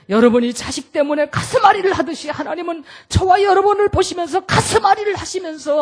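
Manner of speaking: high, loud preaching cry; ringing sermon shout; preaching at full force. Acted.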